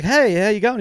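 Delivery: bright, loud, high hello; cheery greeting. Retold or imitated. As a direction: call out a friendly hello. Greeting the islander, retold.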